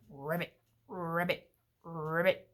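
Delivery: low rolled ribbits; deep-pitched frog call; frog croak. Acted. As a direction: imitate a frog, each ribbit separate. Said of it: "he heard a curious sound".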